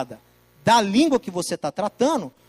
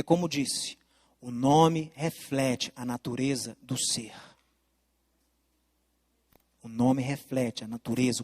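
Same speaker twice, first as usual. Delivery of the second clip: solemn hushed teaching; 8 semitones lower, slower than usual. Acted. Teacher unveiling the mystery of God's name, low and slow. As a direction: draw the listener into a sacred secret.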